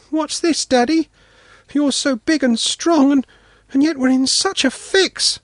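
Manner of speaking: put-on high child's voice, gently reproachful; a small daughter chiding her dad; reproachful little girl. Daughter stuck in the bog reproaches her father, high child voice. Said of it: "with a silent rebuke".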